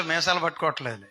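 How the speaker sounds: normal talking voice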